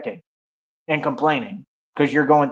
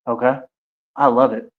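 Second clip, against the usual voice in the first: ordinary talk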